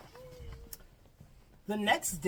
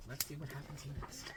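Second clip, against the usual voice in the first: usual speaking voice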